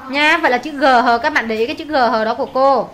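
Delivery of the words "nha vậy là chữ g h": ordinary talk, nothing marked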